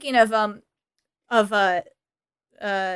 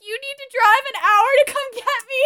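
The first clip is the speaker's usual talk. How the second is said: much higher, hammed-up whine; mock demanding Cher; 11 semitones higher than usual. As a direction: demand the rescue like a spoiled teen. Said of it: "She's putting on a hysterical".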